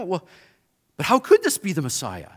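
ordinary talk, nothing marked

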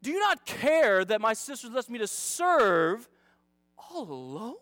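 slow, hammed-up pious complaint; mock Christianese lament. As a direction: overplay the martyr, stretching every key word. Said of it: "Has there ever been a more Christianese sentence in your life?".

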